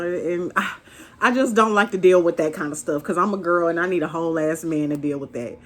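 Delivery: ordinary talk, nothing marked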